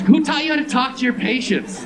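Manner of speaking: high, loud outraged question; indignant shout. Real, not acted. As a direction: yell it in disbelief at the doctor. Comic rant at doctor.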